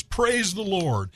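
lightly acted praise, stretched slowly; pious old lady. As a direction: lift it up like a devout porch prayer. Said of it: "and says".

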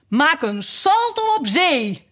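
high, slow, drawn-out cry; frenzied turtle shout, pitched high; wild shouting. A narrator's reading. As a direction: cry it out high while leaping wildly. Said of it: "schreeuwde de nepschildpad woest in het rond springend".

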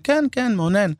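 high-pitched imitation of a son answering; Dov Navon as son. Son answering his father in the car, high-pitched role. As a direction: play the son's reply up high. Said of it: "דוב נבון, הבן, עושה".

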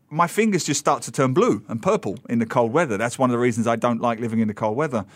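ordinary talk

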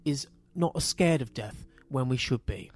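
lightly put-on upper-class English soliloquy; mock posh English. Podcaster parodying the mute character's final monologue, posh English voice. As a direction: imitate a posh philosopher, low-key and measured. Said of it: "he's doing it in posh voice".

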